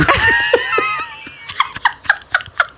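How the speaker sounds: high shrieking laugh; screaming laugh. Real, not acted. Friend losing it over uncle's question, shrieking laughter.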